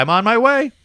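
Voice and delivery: very high, loud cheerful boast; hopeful exclaim. Retold or imitated. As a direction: declare it proudly. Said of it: "I was like".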